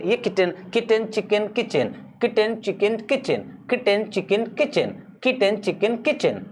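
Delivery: किटन, चिकन, किचन said apart, each word clearly separated; drilling the three similar words; contrasting words. Shown as a demonstration. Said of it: "एक एक शब्द को सही से निकालो".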